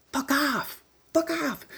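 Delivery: very high squawked curse; squawking peacock voice. Retold or imitated. Peacock telling the hitchhiker off, very high squawk.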